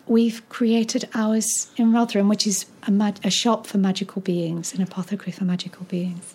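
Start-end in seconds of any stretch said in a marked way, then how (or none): 4.7-6.3 soft fast whisper, unvoiced and hushed. Real, not acted. Telling the host about the magic shop, whispered. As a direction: let it drop to a hurried secret whisper.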